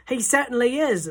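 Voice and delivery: loud, high cheeky shout; boy's shout. A narrator's reading. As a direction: call out smugly from the bag. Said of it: "Bruno shouted from inside the handbag".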